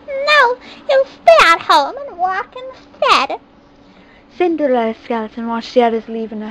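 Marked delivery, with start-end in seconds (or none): 0.1-3.4 high, slow stepmother refusal; cold stepmother. A narrator's reading. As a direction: deny her flatly, slowly. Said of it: "stared and told her".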